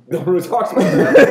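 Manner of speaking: talking through a laugh. Real, not acted. Friend laughing while praising a great blader. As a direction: let the laugh spill into the words.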